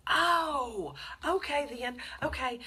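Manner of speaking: high, loud relieved realization; sudden relief, voice jumping up; oh-okay of relief. Retold or imitated. Relief after learning car's location.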